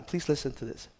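ordinary talk

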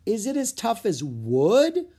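high, drawn-out eager questions; excited, slow and high-pitched curiosity; growing excited. A narrator's reading. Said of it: "growing excited".

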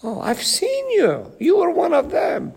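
high accusing voice of a bystander; accusing servant girl. Retold or imitated. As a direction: point the finger at him, high and loud. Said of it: "Three times he denied him because".

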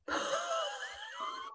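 high-pitched laugh; burst of laughter. Real, not acted. Speaker laughing at her father's jab, high laugh. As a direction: let a sudden laugh burst out.

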